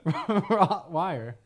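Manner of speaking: "Rottweiler" drawn out as "rot-wire", amused; mispronounced Rottweiler. Shown as a demonstration. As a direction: stumble slowly through "Rottweiler", laughing. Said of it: "One more time".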